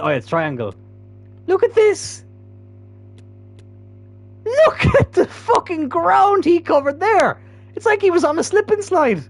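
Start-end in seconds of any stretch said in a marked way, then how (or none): 1.5-9.2 very high, squealing amazement; laughing disbelief. Real, not acted. Gamer replaying the long slide, high laughing disbelief. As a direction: squeal it, nearly helpless with laughter. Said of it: "from laughing at that".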